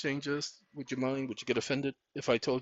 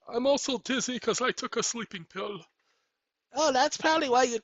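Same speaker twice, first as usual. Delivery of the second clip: higher mock viewer voice; 9 semitones higher than usual. Imagined viewer blames the pill.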